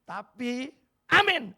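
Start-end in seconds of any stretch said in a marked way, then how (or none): 1.1-1.6 high amen into laugh. Acted. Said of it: "mock amen then laugh".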